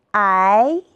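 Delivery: second-tone "I", drawn out and climbing; tone drill. Shown as a demonstration. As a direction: glide "I" upward, second tone. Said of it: "和老师一起比划着读一读吧".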